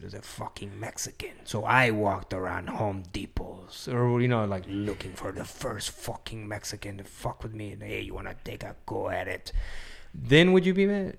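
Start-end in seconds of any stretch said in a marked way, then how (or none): 0.0-9.5 mock grave confession. Host inventing a hypothetical confession to test the others, hushed mock retelling. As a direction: lightly play a sombre confessor, dropping to a whisper. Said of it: "she said".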